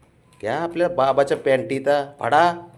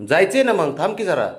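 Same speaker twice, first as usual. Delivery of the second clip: calling out; 9 semitones higher, 6 dB louder than usual. Real, not acted. Calling out to the dog.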